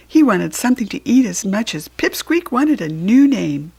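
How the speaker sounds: plain reading voice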